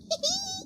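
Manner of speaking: high laugh into a chesty cough. Real, not acted. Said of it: "Sorry, I'm coughing".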